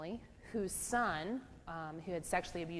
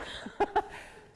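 breathy laugh; 14 semitones higher than usual. Real, not acted. Laughs at her own quip.

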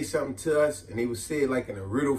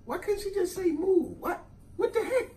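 put-on much higher, softer baffled kid; confused kid; 7 dB quieter than usual. Kid baffled by the aunt's riddle, high and soft. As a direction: squeak out your confusion. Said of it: "I'm like".

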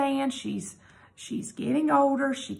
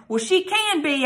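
yelled; 6 semitones higher, 3 dB louder than usual. Acted. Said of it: "I yelled".

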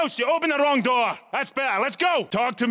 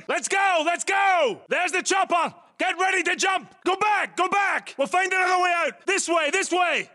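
urgent shouting; 7 semitones higher than usual. Acted. Squad leader yelling to his team to run, shouting. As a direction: bark the escape orders over the gunfire. Escaping under fire, calling out.

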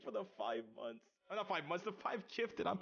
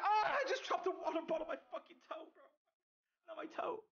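high-pitched squeal after a mishap; pained yelp; 13 semitones higher than usual. Real, not acted. Streamer dropped a water bottle on their toe, pained high yelp. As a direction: yelp it out, voice shooting way up.

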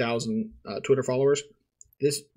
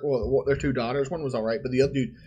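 ordinary talk, nothing marked

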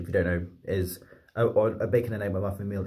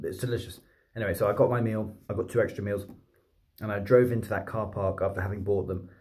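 ordinary talk, nothing marked

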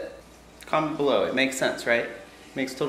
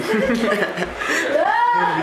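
laughing; 13 semitones higher than usual. Real, not acted. Forgot to hit record.

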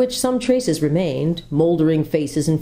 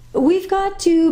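put-on young girl's voice; 13 semitones higher, 3 dB louder, slower than usual. A narrator's reading. Jill starts to answer.

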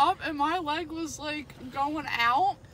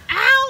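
mock ow; 10 semitones higher, 15 dB louder than usual. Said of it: "You were like".

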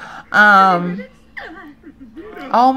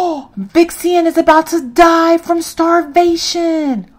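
higher, slow, shocked; real shock at a dying sim; shocked gasp; 8 semitones higher, 4 dB louder, slower than usual. Real, not acted. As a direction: gasp and draw it out in alarm.

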